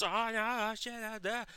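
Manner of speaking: high, loud falsetto babble; mock mom panic. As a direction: shriek helplessly as bees fly overhead. Imitating mom panicking under the bees.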